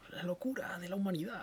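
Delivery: hushed, breathy mock gravity; a mock-ominous whisper about humanity; mock dramatic whisper. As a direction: whisper it like a dark secret. Mock solemn aside.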